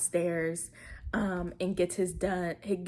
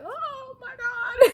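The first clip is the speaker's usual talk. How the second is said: very high, drawn-out imitated wail; hammed-up tearful squeal; mock crying; 5 dB louder, slower than usual. Recapper voicing the caught cheating woman, mock crying. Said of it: "And she starts crying".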